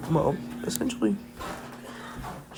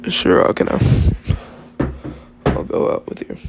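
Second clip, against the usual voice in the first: put-on low boy voice; 9 semitones lower, 7 dB louder than usual. Boy accepts confession, voiced.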